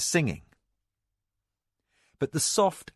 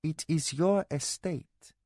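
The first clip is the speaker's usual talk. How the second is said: soft, slow, stressing your; flat repeat; 5 semitones higher, 3 dB quieter, slower than usual. Acted. Mara repeats her point to her angry husband. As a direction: calmly insist again. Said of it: "Mara repeated".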